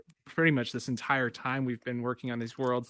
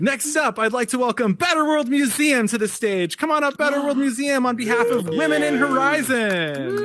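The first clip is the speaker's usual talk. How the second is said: higher, louder emcee welcome; excited announcer; 10 semitones higher, 8 dB louder than usual. Real, not acted. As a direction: hype the crowd for the next guest. Announcing next winner on stage.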